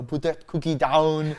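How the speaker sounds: slow, loud Arnold Schwarzenegger voice; Arnold impression. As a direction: bark it like the action-movie star. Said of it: "I'll give you the Arnold".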